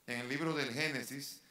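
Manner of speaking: ordinary talk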